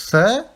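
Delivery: tha with the tongue between the teeth, like English th. Shown as a demonstration. Teacher showing how to say tha, tongue between teeth. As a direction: push tha out through the teeth. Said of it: "vous mettez la langue entre vos dents".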